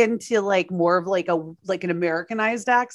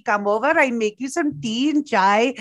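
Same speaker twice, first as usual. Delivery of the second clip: lilting Pakistani accent, coaxing; 2 semitones higher than usual. Shown as a demonstration. Dad offers daughter tea and cookies, Pakistani accent. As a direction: call her over in a Pakistani dad's accent. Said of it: "I can do Pakistani".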